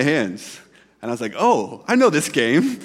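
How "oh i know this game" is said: delighted recognition. Retold or imitated. Recognizing the hand-slap game.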